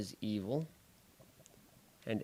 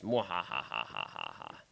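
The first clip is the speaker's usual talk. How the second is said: evil overlord laugh; 9 semitones lower, 5 dB quieter than usual. Acted. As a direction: cackle like a cartoon villain. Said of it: "I am an evil overlord".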